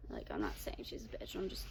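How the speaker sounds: quick whispered aside. Real, not acted. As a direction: whisper it fast, confidingly. Hushed aside to viewers.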